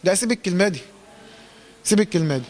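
ordinary talk, nothing marked

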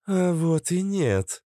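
high, slow female contradiction; lightly voiced Cobra. A narrator's reading. Cobra contradicts Natasha, slow high female voice. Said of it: "сказала Кобра".

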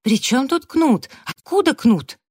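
high-pitched bewildered protest; high, agitated questioning; startled, indignant query. A narrator's reading. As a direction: demand it in flustered disbelief. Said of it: "вскинулся Джастин".

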